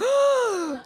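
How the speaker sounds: sharp high gasp of shock. Retold or imitated. Husband gasping at his wife's New York greeting. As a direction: gasp loud and high in mock horror. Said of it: "I was like".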